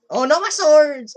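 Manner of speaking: high, loud wail of loss; dismayed cry. Real, not acted. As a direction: cry out over lost treasure. Loses swords in the game.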